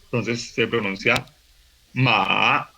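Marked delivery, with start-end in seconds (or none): 1.9-2.7 ma dipping down, then up; third tone. Shown as a demonstration. Teacher showing the third tone, falling-rising ma. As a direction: drop the pitch on MA, then raise it. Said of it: "El tercer tono es descendente, ascendente".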